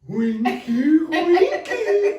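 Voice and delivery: imitating a very high, loud laughing squeal; laughing friend. Friend who keeps laughing instead of explaining, high hysterical mimicry. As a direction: squeal it through giggles as the friend. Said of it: "She just keeps laughing".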